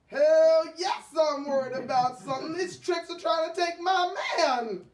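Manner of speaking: loud, fast, high angry rant; angry outburst. Acted. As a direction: shout it in outrage.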